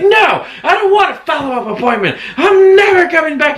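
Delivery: high, loud furious refusal. Retold or imitated. Patient refusing a follow-up appointment, furious shout. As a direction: slam the door on them with your voice.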